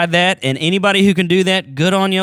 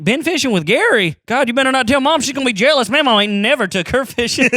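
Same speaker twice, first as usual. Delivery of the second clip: mock teasing; 9 semitones higher, 3 dB louder than usual. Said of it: "I was like".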